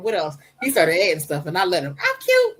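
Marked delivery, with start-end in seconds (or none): 2.0-2.6 put-on very high, squeaky little boy; cute child voice. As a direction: pipe it up like a proud kid. Son adds his own affirmation.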